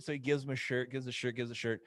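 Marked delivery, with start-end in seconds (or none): none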